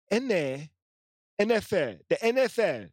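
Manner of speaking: N F L in a put-on Spanish accent; mock Spanish-accented N F L. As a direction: say the letters like a Spanish speaker. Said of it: "I'm trying to do my Spanish accent".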